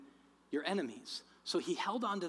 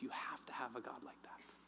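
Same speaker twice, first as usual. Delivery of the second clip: very soft, low near-whisper; hushed answer; 4 semitones lower, 14 dB quieter than usual. Retold or imitated. Preacher voices God answering his protest, hushed whisper. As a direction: whisper it low as a solemn reply.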